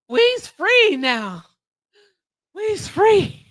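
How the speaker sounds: high, drawn-out mock cry of freedom; mock jubilation. Celebrating after Jesse has finally gone, mock jubilant. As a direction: stretch out "free" in a put-on dialect.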